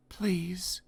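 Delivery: timid whisper. Acted. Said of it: "he whispered".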